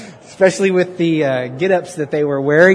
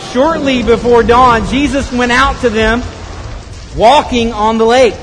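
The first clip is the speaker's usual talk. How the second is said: loud, raised storytelling over a storm; dramatic scripture narration; 6 semitones higher, 5 dB louder than usual. A narrator's reading. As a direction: project the Bible story high and loud.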